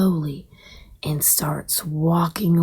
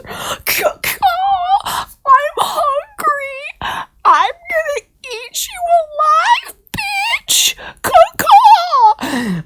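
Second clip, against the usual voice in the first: very high, piercing vulture screech; hungry bird of prey, crying out shrilly; 6 dB louder, much slower than usual. Acted. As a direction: caw and stretch the words into a shriek. Vulture caws and threatens bird.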